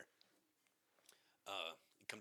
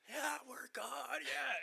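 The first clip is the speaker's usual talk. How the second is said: much higher, slow, louder yeah; giddy reply; 8 dB louder, much slower than usual. Retold or imitated. Answering the neighbors, giddy high excited reply. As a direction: answer bursting with joy. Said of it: "And we're like".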